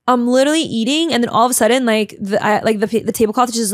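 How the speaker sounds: normal talking voice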